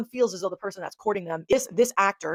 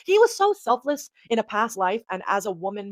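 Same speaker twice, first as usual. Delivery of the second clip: ordinary talk, nothing marked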